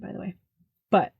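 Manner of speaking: ordinary talk, nothing marked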